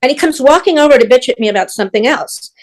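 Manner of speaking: normal talking voice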